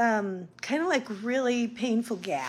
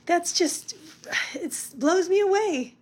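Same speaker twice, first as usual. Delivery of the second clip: higher, louder, awed; moved; 7 semitones higher, 3 dB louder than usual. Real, not acted. Tells viewers how friends touched her, awed. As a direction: let the gratitude lift the voice.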